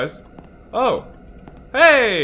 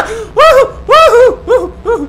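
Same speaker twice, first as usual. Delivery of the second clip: very high loud whoops of relief; whooping; 12 dB louder than usual. Real, not acted. Streamer escaping the ghost lady, loud high whooping. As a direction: whoop while running away.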